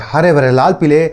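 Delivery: usual speaking voice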